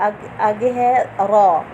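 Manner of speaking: "रॉ" full and heavy, drawn slowly. Shown as a demonstration. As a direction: fill the mouth on "रॉ". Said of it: "रॉ को हमने मोटा पढ़ना है". ("रॉ" मोटा, मुंह भर के)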